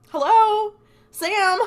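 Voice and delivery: very high, loud mock hail; exasperated calling. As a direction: imitate shouting at someone who can't hear. Dean calls to oblivious Sam.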